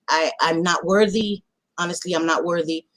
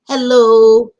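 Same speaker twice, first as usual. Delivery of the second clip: calling out; 9 dB louder than usual. Real, not acted. Calling out to lurkers.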